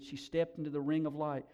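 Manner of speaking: ordinary talk, nothing marked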